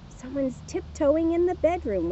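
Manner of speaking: ordinary delivery, nothing marked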